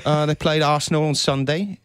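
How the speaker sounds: put-on playful British accent, talking about the Blues. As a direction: chat about Chelsea as a mock British fan. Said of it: "your accent got better as you were talking".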